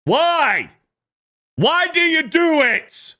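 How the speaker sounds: very high-pitched, exasperated yell; hammed-up high squeal of frustration; exasperated outburst. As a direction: cry out as if you can't take it anymore. Exasperated outburst at the mimicked habit.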